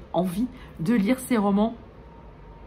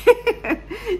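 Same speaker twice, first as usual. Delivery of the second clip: laugh; 14 semitones higher than usual. Real, not acted. Laughs at own hiccups.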